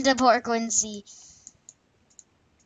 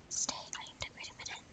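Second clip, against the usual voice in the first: quick breathy whisper, no voice; fast whisper; 15 dB quieter, much faster than usual. Shown as a demonstration. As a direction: rush it out in a hushed whisper. Same line redone whispered.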